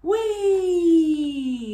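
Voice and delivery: long, high squeal of a ride; drawn-out, high acted whoop; gleeful whee. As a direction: cry out as you zoom along. Riding off on the snails.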